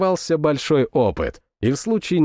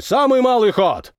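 roared order; 9 semitones higher, 3 dB louder than usual. A narrator's reading. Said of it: "проревел старпом".

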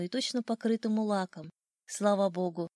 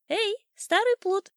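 high, loud, drawn-out bird shout; shouting old sparrow; 12 semitones higher, 6 dB louder than usual. A narrator's reading. Sparrow spotting his enemy the cat, high shout. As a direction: call out tauntingly, high and slow. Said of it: "кричал старый воробей".

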